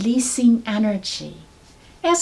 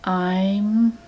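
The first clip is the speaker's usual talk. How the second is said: very slowly; much slower than usual. Shown as a demonstration. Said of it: "say that very slowly, three times".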